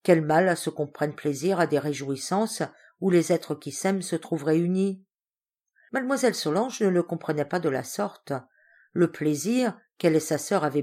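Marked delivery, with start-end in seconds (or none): none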